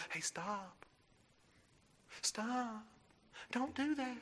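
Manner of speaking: soft, limp "stop"; mild half-hearted warning. Pretend. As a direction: warn the toddler too gently to matter. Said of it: "You'll go".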